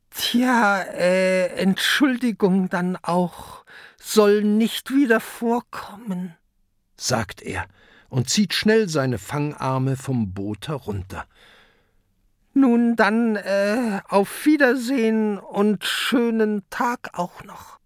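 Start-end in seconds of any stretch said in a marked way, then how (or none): 0.1-6.3 high, hemming creature voice; sheepish kraken apology. A narrator's reading. 12.5-17.8 high, polite creature voice; awkward kraken farewell. A narrator's reading.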